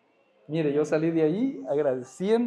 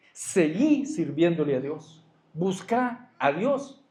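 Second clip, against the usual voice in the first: fervent preaching; 2 semitones higher, slower than usual. Acted. Preacher urging the congregation.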